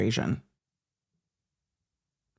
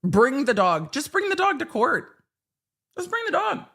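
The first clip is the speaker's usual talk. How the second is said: mock demanding judge; 7 semitones higher than usual. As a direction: mimic a judge insisting, rising into a squeak. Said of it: "waiting for this judge to say".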